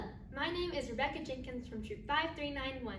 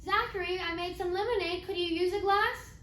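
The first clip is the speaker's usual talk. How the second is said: higher, louder call to someone out of sight; raised-voice offer, pitched up; 5 semitones higher, 5 dB louder than usual. Acted. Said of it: "Then I called out to Zachary".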